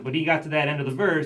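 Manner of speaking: usual speaking voice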